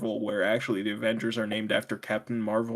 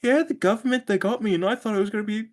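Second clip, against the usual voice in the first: hammed-up British accent, pitched well higher; mock British accent; 10 semitones higher, 3 dB louder than usual. Mocking the character's British accent, pitched higher. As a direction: camp it up as a Brit soldier. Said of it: "He's like".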